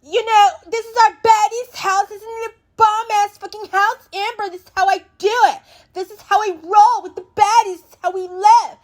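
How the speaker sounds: high, put-on bragging host voice; Natalie bragging. Host showing the girls the house, high mock bragging. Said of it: "Natalie gotta make it seem like".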